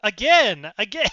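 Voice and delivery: loud, indignant exclamation; exasperated outcry. Real, not acted. Player protesting that everyone walks away, loud exasperation.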